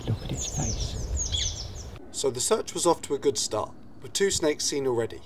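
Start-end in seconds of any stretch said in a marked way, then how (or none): 0.1-1.7 slow, breathy whisper of awe; awed whisper. Real, not acted. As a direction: whisper it in wonder. Admiring whisper at snake.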